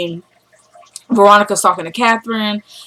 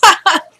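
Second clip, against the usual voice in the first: high imitated laugh; mock scoffing laugh; 8 semitones higher than usual. Mother dismissing son's threat, high mock laugh.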